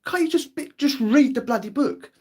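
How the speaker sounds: begging his nan to just read the book; exasperated plea. Retold or imitated. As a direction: stretch it out in disbelief, way up high.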